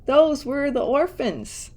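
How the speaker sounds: a hammed-up cry of dismay; shrieked. A narrator's reading. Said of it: "shrieked Aunt Figgy".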